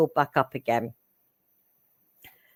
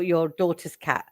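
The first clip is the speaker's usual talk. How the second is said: normal talking voice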